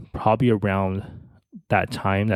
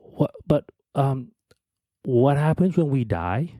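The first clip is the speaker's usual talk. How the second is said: put-on higher, halting child's question, lightly tearful; imitating a crying child, pitched up; retold tearful question; 5 semitones higher than usual. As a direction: ask it haltingly, as if between sobs. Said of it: "in between crying, I go".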